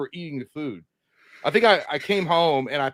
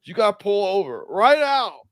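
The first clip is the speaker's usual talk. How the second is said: slow, higher-pitched drunk imitation; urgent drunk demand; 5 semitones higher, 4 dB louder, slower than usual. Sick drunk passenger asking the driver to stop, slow urgent demand.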